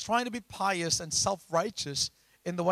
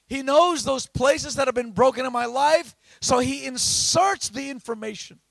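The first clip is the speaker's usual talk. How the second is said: loud, raised-pitch scripture proclamation; full-force preaching; 8 semitones higher, 8 dB louder than usual. Acted. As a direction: belt out the verse to the congregation.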